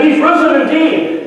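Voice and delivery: high, ringing call-and-response answer; crowd reply. Retold or imitated. Hundreds in the crowd answering the priest. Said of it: "hundreds of people said".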